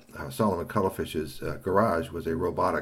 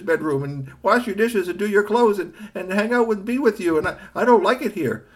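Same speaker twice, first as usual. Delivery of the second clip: pleading robot; 12 semitones higher, 6 dB louder than usual. A narrator's reading. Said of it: "on the verge of having a breakdown".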